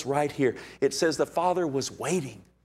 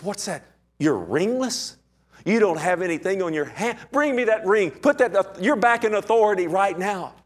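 eager father; 7 semitones higher than usual. Retold or imitated. Father orders the ring.

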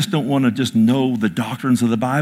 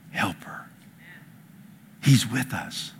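hushed; 9 dB quieter than usual. Real, not acted. Preacher drops to a hush.

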